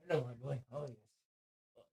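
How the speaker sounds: soft, low cooing to a pet. Real, not acted. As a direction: coo at the dog gently. Baby talk to the dog.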